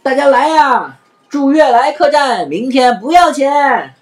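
loud, high, slow street call; hawking call. Acted. Afanti calling passers-by in, loud and slow. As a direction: call the crowd in like a hawker. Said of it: "阿凡提又向外吆喝".

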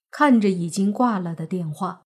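plain reading voice

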